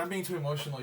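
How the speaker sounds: ordinary talk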